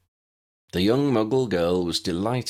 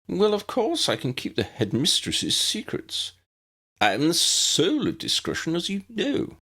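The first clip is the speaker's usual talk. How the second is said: higher, self-important grand lady voice; pompous portrait lady; 10 semitones higher than usual. Acted. The painted lady boasts to Tessa, higher and self-important. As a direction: preen as the soul of discretion.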